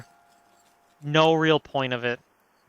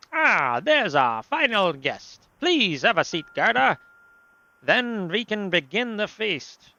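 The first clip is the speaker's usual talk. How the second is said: put-on slow, higher, theatrical foreign accent; grand host with a drawn-out European accent; 6 semitones higher, slower than usual. Host welcoming the final guest to the feast, thick put-on accent. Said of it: "I'm giving him that accent".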